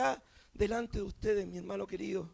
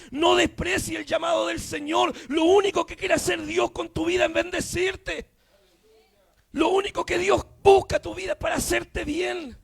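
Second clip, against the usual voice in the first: raised, insistent preacher's appeal; impassioned pulpit appeal; fervent preaching exhortation; 3 semitones higher, 7 dB louder than usual. Real, not acted.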